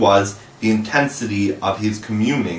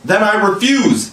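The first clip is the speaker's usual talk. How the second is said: put-on boy defying the pirate captain; defiant cry; 11 semitones higher than usual. A narrator's reading. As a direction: fling the refusal at him. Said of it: "he cried".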